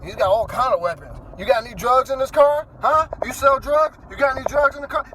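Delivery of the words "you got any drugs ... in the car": pushy cop questions. Retold or imitated. Cop interrogating about drugs.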